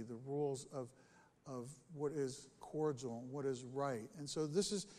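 usual speaking voice

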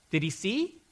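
ordinary delivery

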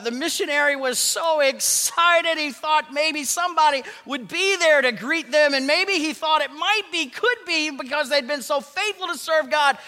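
high, loud, rising excitement, slowing at the end; excited build. Retold or imitated. Preacher telling of missionary's hope at the port, excited and high.

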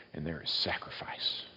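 hushed whisper. Real, not acted. Preacher closing his list of the sailors' acts in a whisper. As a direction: drop to a reverent whisper. Sermon point dropping to whisper.

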